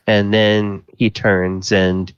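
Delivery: normal talking voice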